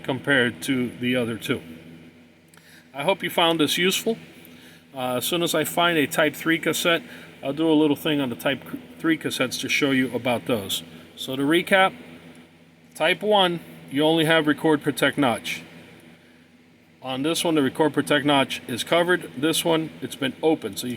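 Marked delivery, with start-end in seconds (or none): none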